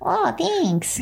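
put-on high, thin old lady try; a failed attempt at the granny voice. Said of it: "Oh, that didn't work".

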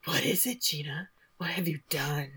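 hushed, whispery mock-dramatic voiceover; soft, suspenseful whisper, hammed up; ominous voiceover. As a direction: imitate a foreboding narrator, sinking into a whisper. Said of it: "Lenny's voiceover says she knew something was wrong".

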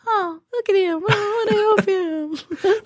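high, cooing mock sympathy; mock pity. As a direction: coo over him like a helpless puppy. Imagined people cooing over him.

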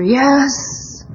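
high, drawn-out enthusiastic yes; eager yes. Retold or imitated. Speaker quoting her program hero agreeing to sponsor, eager. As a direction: draw it out high, jumping at the request.

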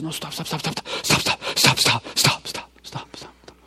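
pleading stop, sinking to a whisper; fading panicked pleas. Retold or imitated. Preacher retelling the terrified killer's plea, fading to a whisper. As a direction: beg them to stop until the voice dies away. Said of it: "He freaks out".

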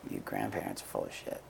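low, hushed undertone; confiding aside. Retold or imitated. Mom elbowing her kid during grandparents' brag, hushed confiding aside. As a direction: lean in and share it on the quiet.

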